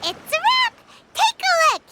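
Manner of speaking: bright, loud, high call of triumph; excited announcement. Real, not acted. Paimon proudly presenting the photo to Shogun, excited loud call. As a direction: proudly unveil the finished picture.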